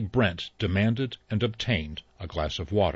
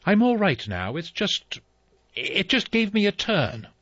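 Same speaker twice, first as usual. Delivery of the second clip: shaken woman voice; 11 semitones higher than usual. Acted. Mrs Rogers recovering.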